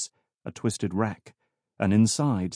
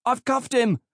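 high, straining officer's call; strained with effort. A narrator's reading. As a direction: force it out mid-struggle. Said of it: "her voice strained with effort".

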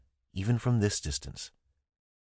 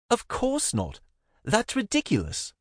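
much higher indignant woman's voice; Zara, indignant. A narrator's reading. Zara scoffing at the murder question, indignant and high.

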